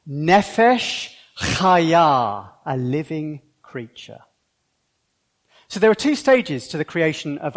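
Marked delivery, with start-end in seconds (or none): 0.1-2.5 Nefesh Chaya slow with a throaty ch; over enunciating. Shown as a demonstration. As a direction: draw out Nefesh Chaya dramatically. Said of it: "all together now".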